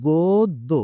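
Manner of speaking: গোদ্দ said as "godd-o", the দ doubled and held; teacher models গোদ্দ with a doubled দ; pronunciation demo. Shown as a demonstration. Teacher to students, modelling the doubled দ in গোদ্দ. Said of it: "এটা উচ্চারণটা হচ্ছে".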